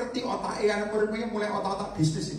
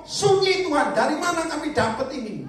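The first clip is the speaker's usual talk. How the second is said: high, loud whining doubter; high mock whine of doubt; mocking doubtful whine; 8 semitones higher, 3 dB louder than usual. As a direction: whine it like a worried believer. Mind voicing doubt.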